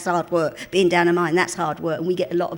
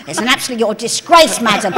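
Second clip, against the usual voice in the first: imitating a louder, scandalised scolding; mock outraged shout; 2 semitones higher, 10 dB louder than usual. Kenny scolds a woman on holiday, hammed-up loud outrage. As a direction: bellow the disgrace at her in mock outrage. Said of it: "And he said".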